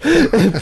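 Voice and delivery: short laugh while coughing; laughing through pain. Real, not acted. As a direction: laugh despite a sore throat. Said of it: "no me hagas reír, que yo también tengo tos".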